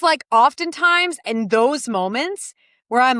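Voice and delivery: ordinary talk, nothing marked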